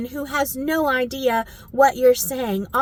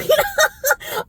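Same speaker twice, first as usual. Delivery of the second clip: laughing; 4 dB louder than usual. Real, not acted.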